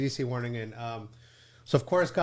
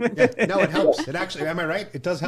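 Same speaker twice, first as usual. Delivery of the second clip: louder, laughing while agreeing; 6 semitones higher, 7 dB louder than usual. Real, not acted. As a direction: laugh through the reply.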